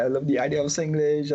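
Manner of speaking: ordinary talk, nothing marked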